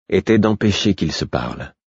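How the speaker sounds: usual speaking voice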